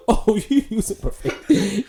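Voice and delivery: high, loud, laughing [name] imitation; mock [name]. Imitating [name] scolding a texter, high and laughing. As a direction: play [name] mock-scolding mid rant. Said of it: "read his text messages in his voice".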